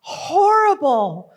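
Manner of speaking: theatrical outburst of loathing; appalled protest. Acted. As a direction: wail in exaggerated dismay. Hating healing school, acted protest.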